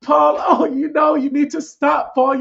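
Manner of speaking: squealing, giggly impression of a soft interview voice. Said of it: "that soft voice, that little soft female voice".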